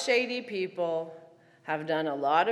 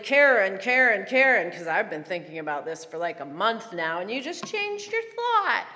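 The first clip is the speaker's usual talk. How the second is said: mock exasperation; 5 dB louder than usual. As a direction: act out protesting to a friend, starting loud. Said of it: "probably a loud groan, or maybe I was like".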